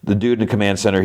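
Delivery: ordinary delivery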